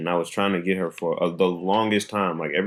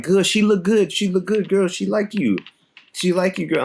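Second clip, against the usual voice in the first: put-on French-accented friend; 10 semitones higher than usual. Pierre voiced with accent.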